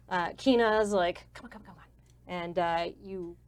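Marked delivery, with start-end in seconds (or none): 1.3-1.8 very soft, murmured chivvying; hushed urging. Acted. Kina leading visitors backstage, urging quietly. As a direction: urge them on under your breath.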